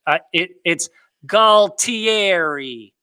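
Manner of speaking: Galtieriをゆっくり区切ってガル・ティエリと読む; Sopranos pronunciation. Shown as a demonstration. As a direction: Galtieri slowly, syllable by syllable. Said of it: "We got the phonetic pronunciation".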